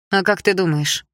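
usual speaking voice

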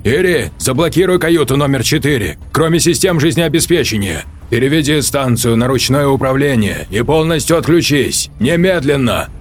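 high, loud barked orders; urgent commands. Acted. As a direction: order it done now.

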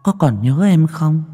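lightly voiced, high, chilling female ghost; ice-cold ghost woman. A narrator's reading. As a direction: greet old acquaintances with eerie coldness. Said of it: "nói bằng cái giọng lạnh ngắt".

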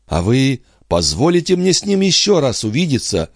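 lightly voiced woman asking a favour; pleading mother. Мать просит о встрече. (мать просит, повышенным голосом)

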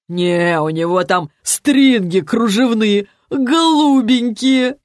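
slow, drawn-out leer rising high; lewd drunk teasing. A narrator's reading. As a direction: savour the dirty joke. Drunk pal joins lewd teasing. (тягучая похабная издёвка)

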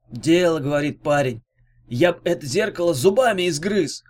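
toothless heckler's put-on voice; lively character voice, mildly shouted; shouting old rascal. A narrator's reading. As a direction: call out approval as a gap-toothed rogue. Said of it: "крикнул Факелт".

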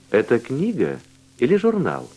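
usual speaking voice

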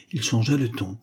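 ordinary delivery, nothing marked

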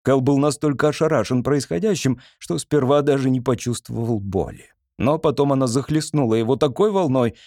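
usual speaking voice

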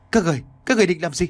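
terrified, words tumbling out fast; trembling fear. Acted. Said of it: "anh Cửu run rẩy nói".

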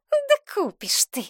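put-on high, slow scornful girlfriend; hissed retort. A narrator's reading. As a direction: scoff in a drawn-out high voice. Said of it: "прошипела девица". (язвительно, высоко и протяжно)

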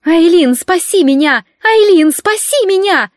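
high, loud screamed cry for help; panicked shrieking for rescue; shrieked plea. Acted. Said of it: "завизжала госпожа Линнет".

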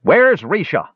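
high-pitched demand from a crowd. A narrator's reading. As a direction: call out sharply for an answer. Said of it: "demanded someone from the back of the mob".

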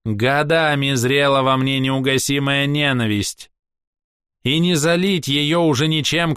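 haughty elf, high ringing voice; theatrical, scornful ranting; proud elf. Acted. Castiel's hatred.

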